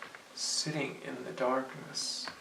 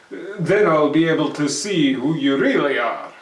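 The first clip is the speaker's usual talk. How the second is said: put-on louder, brash young man brushing it off; bold Herschel, scoffing; 2 semitones higher, 11 dB louder than usual. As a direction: call his bluff out loud. Herschel tricks the goblin.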